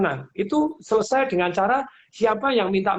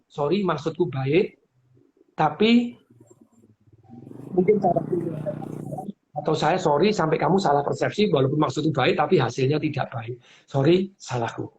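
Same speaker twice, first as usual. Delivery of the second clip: sincere apology; 4 semitones lower than usual. Shown as a demonstration. Advising a spouse how to apologise, low and sincere. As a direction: apologise gently and mean it. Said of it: "nadanya harus tepat".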